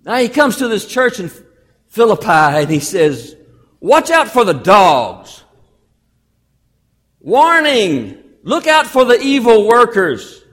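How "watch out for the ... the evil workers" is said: urgent loud warning, drawn out; raised-voice pulpit warning; preached warning. Acted. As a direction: sound the alarm as you read. Reads Paul's warning aloud.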